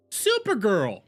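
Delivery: ordinary talk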